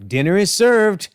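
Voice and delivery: shouting out. Retold or imitated. Aunt May calling the family to dinner, shouting out. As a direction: call everyone to the table. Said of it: "Aunt May shouts out".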